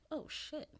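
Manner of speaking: high, soft startled exclamation; mock surprise. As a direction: mimic their shock. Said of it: "they're like".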